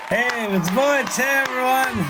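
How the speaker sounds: hearty entrance shout. Real, not acted. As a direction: shout a greeting as you join the show. Greeting the panel after being introduced.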